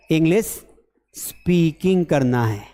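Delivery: slow, with a pause after English; deliberate repetition. Shown as a demonstration. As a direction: drive it home word by word. Repeats goal slowly for class. (रुक-रुककर, धीरे)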